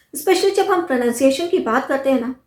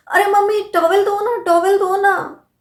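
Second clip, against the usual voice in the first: put-on higher-pitched child calling to mummy; small child; 6 semitones higher than usual. Kid fresh from a bath asks mother, higher childlike plea. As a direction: plead like a kid wanting something. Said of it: "जब छोटे बच्चे होते हैं ना तो बोलते हैं".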